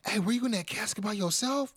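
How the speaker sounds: high-pitched comic goading; R-Truth. Retold or imitated. Acting Truth goading Waller about casket.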